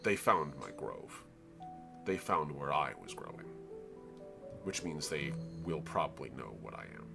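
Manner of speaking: a patient ancient tree rumbling. Acted. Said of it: "First Degree Sun rumbles back".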